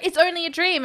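high pleading voice. Retold or imitated. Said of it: "she's like".

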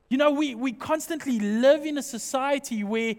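ordinary delivery

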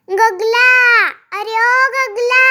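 calling out. A narrator's reading. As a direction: shout the name slowly across the field. Mother calls son from play. (आई मुलाला लांबून हाक मारते)

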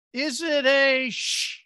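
slow, loud, stretched-out question; mock suspense, word left hanging; dawning dread, acted. Acts out dawning shark fear.

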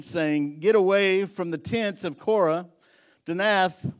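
loud, high fervent preaching. Acted. Pastor urges the congregation to listen, fervent preaching. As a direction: lift the voice and press the point.

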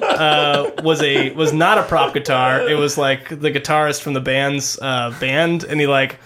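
normal talking voice

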